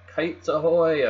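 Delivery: high, loud, drawn-out hail; calling out. A narrator's reading. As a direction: sing out a sailor's "ahoy". Calling out as kite flies.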